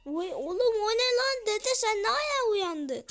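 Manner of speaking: high, loud cry of alarm; jolted into a high-pitched outburst; startled shout. Real, not acted. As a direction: yell in shocked disbelief. Startled as grandpa suddenly gets up.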